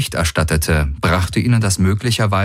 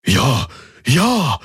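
joyful cry; 6 semitones higher than usual. Acted. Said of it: "freudig ausrief".

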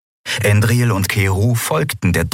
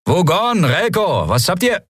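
raised, high-pitched call to the crew; urgent call; 12 semitones higher than usual. A narrator's reading. Pilot to crew in space battle, urgent call. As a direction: call out for a report.

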